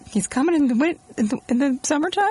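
puzzled, rising disbelief; baffled by the idea; confused surprise. Retold or imitated. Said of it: "but I was like".

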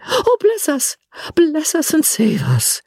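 very high, theatrical dismay; alarmed exclamation. Acted. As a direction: cry out as if suddenly realising disaster. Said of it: "broke off and exclaimed".

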